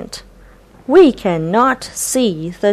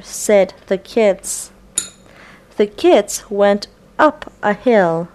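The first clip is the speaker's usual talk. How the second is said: ordinary delivery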